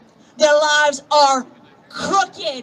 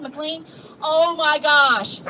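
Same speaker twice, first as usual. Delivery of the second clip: ordinary delivery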